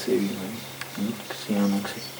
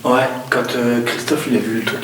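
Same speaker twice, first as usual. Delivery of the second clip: ordinary talk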